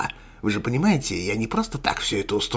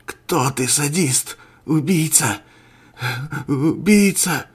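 raised, strained accusations; anguished, higher-pitched raspy outburst; hoarse raging; 6 semitones higher, 6 dB louder, slower than usual. Acted. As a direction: cry out hoarsely at the killer. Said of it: "хрипел Дольский".

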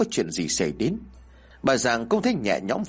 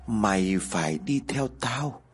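put-on a spirit speaking through the child; distant ghostly voice; 2 semitones lower, slower than usual. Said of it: "Âm thanh như từ đâu xa xăm chứ không phải do nó nói".

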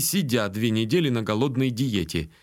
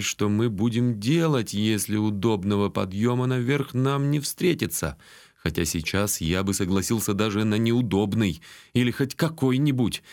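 plain reading voice

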